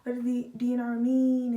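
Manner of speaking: retold angry demand, loud; lashing out. Daughter re-enacting her demand to responders, lashing out loudly. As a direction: burst out loudly with the question. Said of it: "boom, lash out".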